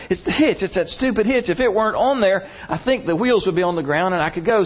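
high-pitched, exasperated retold panic; hammed-up flustered stranded driver; mock panic. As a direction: imitate a man frantically blaming his equipment. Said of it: "he's in a panic".

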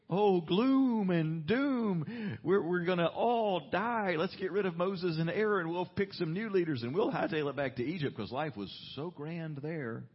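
high, loud, slow whiny wail of doom; mock panicking crowd. Preacher mocking the panicking Israelites, whiny high wail. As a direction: ham up the gloom and doom.

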